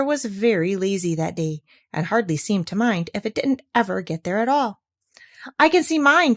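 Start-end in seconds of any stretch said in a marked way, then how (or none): 5.6-6.3 high, loud eager cry; excited cry. A narrator's reading. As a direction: shout it out like an excited little kangaroo. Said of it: "cried Roo".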